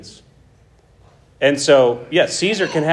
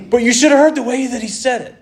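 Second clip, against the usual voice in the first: a raised, whining complaint about how he said it; whiny objector; 7 semitones higher than usual. Retold or imitated. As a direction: protest like a petty accuser.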